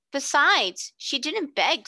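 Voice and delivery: put-on a girl pressing her point; Janet's girl voice. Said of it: "said Janet".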